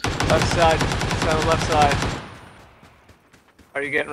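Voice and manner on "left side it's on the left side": callout shout. Real, not acted. Calling enemy position in firefight.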